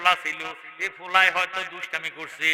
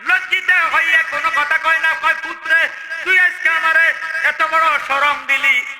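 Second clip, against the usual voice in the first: high, loud chanted sermon delivery; full-force preaching; 11 semitones higher, 7 dB louder than usual. Acted. Preacher telling of the shamed father, high chanted preaching. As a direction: address the crowd on a high, ringing note.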